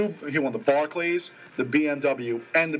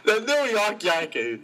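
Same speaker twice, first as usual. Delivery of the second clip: high put-on Australian accent; mock accent; 7 semitones higher than usual. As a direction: tease him by copying the Aussie voice. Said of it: "That was really good".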